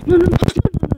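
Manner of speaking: high, loud string of no; panicked shout. Real, not acted. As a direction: cry out in panic. Is about to fail.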